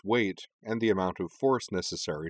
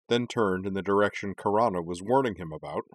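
plain reading voice

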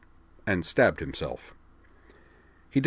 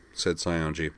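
ordinary delivery

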